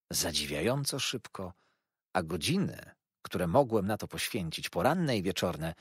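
usual speaking voice

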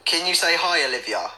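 usual speaking voice

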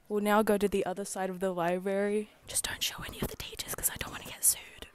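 breathy ASMR whisper. Shown as a demonstration. Doing ASMR for the vlog, whispered. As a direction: whisper it for brain tingles. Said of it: "A S M R".

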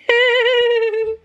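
sudden high laugh. Real, not acted. Wife laughing at dog and daddy reunion, high laugh. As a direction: let out a bright squeal of laughter.

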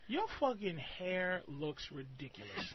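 slow, low, quiet put-down; deadpan insult. Shown as a demonstration. Host shows caller an insult to practise, deadpan. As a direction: say it coolly, without any heat.

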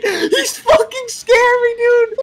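high, loud terrified screaming; panicked cries while fleeing; being chased. Real, not acted. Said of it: "He's scary".

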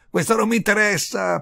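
ordinary delivery, nothing marked

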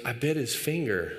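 put-on low-pitched little-kid confession; imitated confessing son. Son admitting it to his parent, imitated guilty confession. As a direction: own up like a guilty child, mimicked. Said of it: "And he goes".

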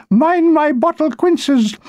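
high, slow, fussy shout; a woman on the step shouting anxiously. A narrator's reading. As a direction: cry out over her precious jars. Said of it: "kept shouting".

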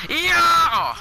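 high, loud shouted cheer; triumphant yell. Real, not acted. Gamer landing a hit, triumphant yell. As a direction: yell out in victory. Celebrates in game.

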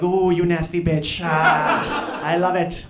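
loud, relishing devil; gleeful Devil. Acted. Devil delighted by Hitler's answer, loud gleeful relish. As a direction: cackle with loud, wicked approval. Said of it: "And the Devil's like".

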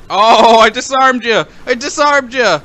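excited gloating. Real, not acted. Player who just disarmed the enemy, excited gloating. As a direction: crow it at the enemy.

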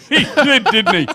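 high, loud laughing reply. Real, not acted. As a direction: laugh through the words.

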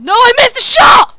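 loud angry yell; frustrated shout. Real, not acted. Missed the shot.

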